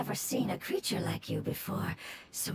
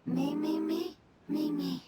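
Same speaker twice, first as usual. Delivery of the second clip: repeated chirping "me" calls, pitched higher; sing-song little creature calling out; small creature chanting its own tongue; strange creature voice; 4 semitones higher than usual. Acted. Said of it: "using this strange voice".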